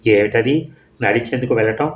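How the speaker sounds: ordinary delivery, nothing marked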